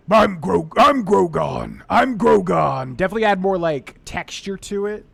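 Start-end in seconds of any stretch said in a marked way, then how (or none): 0.1-2.9 slow, loud orc growl of a name; old Grogon orc voice. Retold or imitated. Voice actor recalling old orc voice, slow and loud. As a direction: announce yourself as a lumbering orc. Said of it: "It was like".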